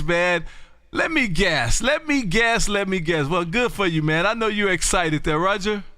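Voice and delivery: normal talking voice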